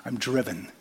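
normal talking voice

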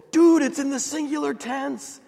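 high-pitched, hammed-up geeky thrill; intense excitement. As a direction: imitate yourself getting worked up over grammar. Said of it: "I get really intense".

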